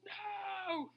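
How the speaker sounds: high, soft pouty no; a hint of a little girl refusing; lightly voiced toddler no; stubborn child's no.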